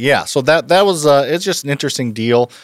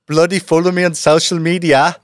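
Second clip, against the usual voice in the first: higher attempt at British, slipping into Irish; UK accent; 8 semitones higher, 4 dB louder than usual. Shown as a demonstration. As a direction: go for London and land nearer Irish. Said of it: "a U K accent".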